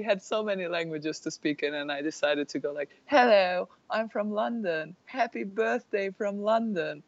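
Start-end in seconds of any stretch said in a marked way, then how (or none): 3.1-7.0 high, singsong mock London English; put-on Londoner birthday greeting in a British accent. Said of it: "a message for her birthday in British accents".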